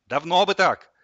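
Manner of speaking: loud, high, satisfied exclamation; triumphant. Acted. Boy finally gets fridge open, loud triumph. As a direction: crow it out loudly.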